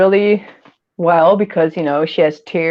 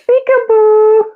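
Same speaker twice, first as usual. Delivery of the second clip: playful peekaboo; 4 dB louder, slower than usual. Pretend.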